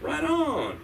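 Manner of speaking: high, drawn-out approval; hammed-up easygoing acceptance; appeased stranger. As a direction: imitate a stranger suddenly friendly. Homeless man accepts the apology.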